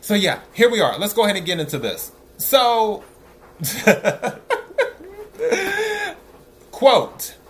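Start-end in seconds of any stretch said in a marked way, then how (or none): 3.6-6.1 high, breathless burst of laughter; big laugh. Real, not acted. Laughs at the comment.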